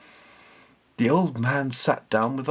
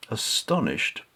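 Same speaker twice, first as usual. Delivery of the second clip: plain reading voice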